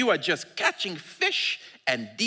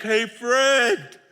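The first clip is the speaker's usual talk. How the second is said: gentle Jesus; 4 semitones higher, 4 dB louder, slower than usual. Acted. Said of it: "It's like".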